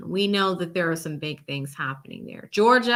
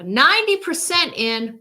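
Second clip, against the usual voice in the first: suddenly louder and higher, real excitement; raised voice; 6 semitones higher, 7 dB louder than usual. Real, not acted. Streamer reacting to Florida results, raised voice. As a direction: blurt the number out loud. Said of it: "sorry to raise my voice there".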